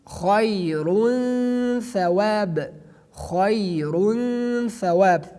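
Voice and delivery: very slow khayrun thawab, nasal held before th; drawn-out ikhfa. Shown as a demonstration. Teacher reciting khayrun thawab slowly. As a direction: draw out khayrun thawab with ghunna. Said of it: "teacher demonstrating ikhfa slowly".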